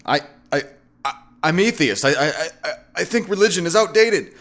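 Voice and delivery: high, nervous false starts; trying to argue while rattled; flustered stammering. A narrator's reading. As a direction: stumble on the words, pitch climbing. Flustered narrator stammers out his position.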